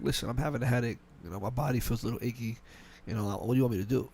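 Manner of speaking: a hint of a poor, achy employee; sickly tone. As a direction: play up feeling unwell to the boss. Texting boss about being sick.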